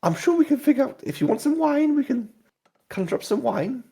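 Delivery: high, chiding barmaid voice; tavern owner voice. Acted. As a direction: scold him like a prim landlady. Lara voice.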